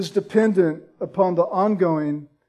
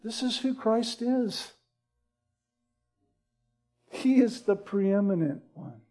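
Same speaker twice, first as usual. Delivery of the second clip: hushed earnest appeal; 5 dB quieter than usual. Acted.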